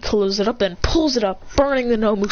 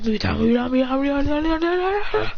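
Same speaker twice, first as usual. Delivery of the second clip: barrage battle cry; 4 semitones higher than usual. Acted. Burying him with the Muda, rising battle chant. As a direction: chant starting level, climbing higher to the end.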